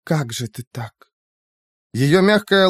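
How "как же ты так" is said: much softer, hushed grief; soft whisper. A narrator's reading. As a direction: barely breathe the words. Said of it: "тихо прошептала она".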